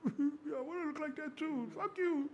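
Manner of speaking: high, strained whiny voice with a laughing cry; mock envious whine. Telling the guest how he envied thick builds, mock whining.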